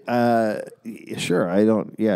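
usual speaking voice

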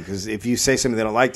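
normal talking voice

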